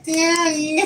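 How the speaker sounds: wife voicing Oliver to her husband, a pleading daddy; voicing the dog. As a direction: speak for the dog begging daddy. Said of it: "Oliver's like".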